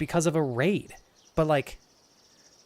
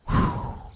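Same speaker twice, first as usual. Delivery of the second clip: long, blown-out exhale; steadying breath. Real, not acted. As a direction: blow out slowly to keep from crying. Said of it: "just trying to breathe".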